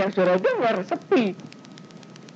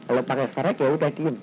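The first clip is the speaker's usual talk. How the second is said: ordinary delivery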